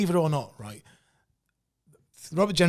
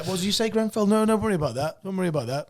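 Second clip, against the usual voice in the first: higher, breezy salesman brushing it off; mock reassuring sales patter; dodgy salesman voice; 6 semitones higher than usual.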